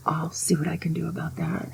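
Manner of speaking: whispered mock threat; whispering like a mob boss; conspiratorial whisper. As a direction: plot it under your breath. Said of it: "mock threat aside".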